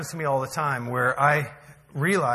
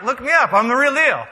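loud, high, insistent; mock-indignant boast; 8 semitones higher, 8 dB louder than usual. Pastor defending his credentials, loud mock-indignant boast. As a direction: protest it loudly for laughs.

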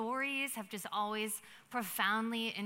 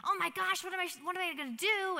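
higher-pitched, fast panicked outburst; frantic; 8 semitones higher, 3 dB louder, faster than usual. Acted. Friend running for refuge explains the danger, frantic. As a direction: blurt it out in a rush of panic. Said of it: "he's frantically explaining what's happening".

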